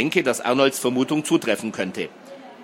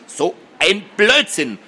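slow, raised, exasperated rebuke; snapping angrily; 6 semitones higher, 4 dB louder, slower than usual. A narrator's reading. Brother dismissing his sister's fears, snapping angrily. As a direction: stretch each word in irritation. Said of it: "fuhr Arnold sie an".

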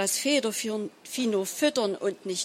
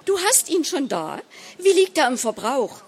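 higher, eager astonished questions; astonished wife, voice raised in pitch; wide-eyed surprise; 6 semitones higher, 4 dB louder than usual. A narrator's reading. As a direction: react with surprise, pitch lifting. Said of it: "Sie bekam große Augen".

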